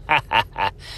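short chuckle; brief self-amused laugh. Real, not acted. Amused by his naughty-or-nice joke, short chuckle.

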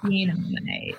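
playful Aussie-accented slang; Australian accent. Shown as a demonstration. Joining in a friend's mimicry, Australian accent. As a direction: toss it off like an Aussie. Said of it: "only thing I know how to say".

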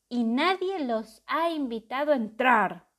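loud booming monster voice; booming terrible voice. A narrator's reading. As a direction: boom it out like a beast. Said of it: "retumbó una voz terrible".

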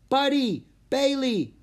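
high, loud screamed names; screaming. A narrator's reading. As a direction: scream for lost pets. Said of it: "a girl screamed".

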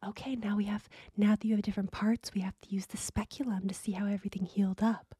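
whispered mock ASMR clinician voice; imitated ASMR roleplay whisper. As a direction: whisper it like the creepy roleplay video. Quoting the ASMR roleplay video.

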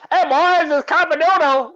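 put-on high, loud old-timey character holler; Copper Doodle. Shown as a demonstration. Caller demonstrating his comedy manager persona's intro, high loud old-timey voice. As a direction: belt out a high-pitched old-timey catchphrase. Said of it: "It's hard to get the voice".

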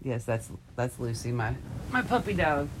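ordinary talk

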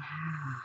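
soft, slow sigh out; relaxing exhaled sigh. Shown as a demonstration. Guide showing listeners how to exhale, soft sigh. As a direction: let the breath go in a quiet sigh. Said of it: "exhale with a bit of a sigh".

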